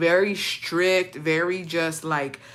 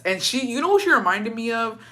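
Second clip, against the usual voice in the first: ordinary delivery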